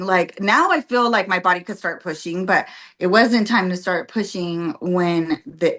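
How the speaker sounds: ordinary talk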